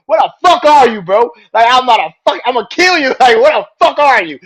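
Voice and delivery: high, loud mock rage; furious yell. Riddle yelling at Seth during the break, imitated furious shout. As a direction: scream it like you'll kill him. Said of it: "Matt Riddle was so fucking mad".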